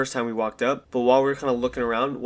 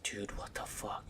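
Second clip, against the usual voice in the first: soft, slow scared whisper; frightened whisper to friends; whispered alarm; 4 semitones lower, 18 dB quieter, slower than usual. Retold or imitated. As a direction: hiss it in fear under your breath. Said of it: "And we're all like".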